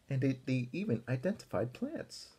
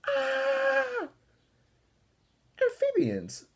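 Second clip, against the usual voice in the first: high, loud startled scream; 5 dB louder, much slower than usual. Acted. Arthur screaming at frogs under his covers, high and loud. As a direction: shriek at the frogs in the bed. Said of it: "Arthur screamed".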